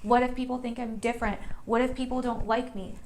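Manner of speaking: normal talking voice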